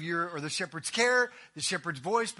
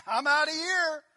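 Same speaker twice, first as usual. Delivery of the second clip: lightly acted, higher-pitched bail-out; mock quitter's cry, pitched up; fleeing hireling; 7 semitones higher than usual. Hireling running from the wolf, mock panicked exit.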